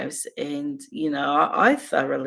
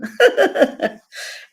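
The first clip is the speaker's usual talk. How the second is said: sudden high laugh, much louder; amused laugh; high burst of laughter; 6 dB louder than usual. Real, not acted. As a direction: break into a loud high laugh. Laughing at her own point.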